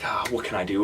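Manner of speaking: usual speaking voice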